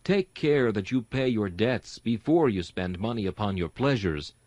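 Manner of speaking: ordinary talk, nothing marked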